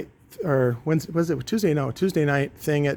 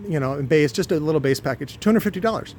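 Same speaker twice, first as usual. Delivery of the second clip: ordinary talk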